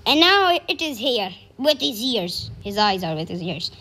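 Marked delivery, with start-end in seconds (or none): none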